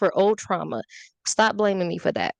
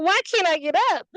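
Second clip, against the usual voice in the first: comic disbelief; 10 semitones higher than usual. Retold or imitated. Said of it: "I'm like".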